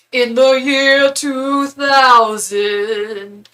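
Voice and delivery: very slow, loud, drawn-out spooky announcer voice; hammed-up late-night sketch voice; Conan bit. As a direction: stretch each word like a TV gag. Conan's famous bit, quoted.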